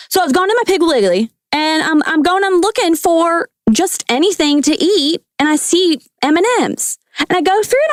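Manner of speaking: high-pitched Southern storyteller; Southern accent. Acted. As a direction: spin a tale in a put-on Southern twang. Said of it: "talk in a Southern accent, please".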